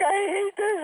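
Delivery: very high mock wail; imitated crying. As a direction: squeal it like a daughter in tears. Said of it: "and she's crying".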